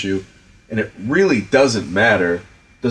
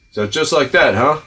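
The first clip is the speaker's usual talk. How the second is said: put-on [name]'s American accent; 6 semitones higher, 4 dB louder than usual. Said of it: "he, like, dropped the British thing".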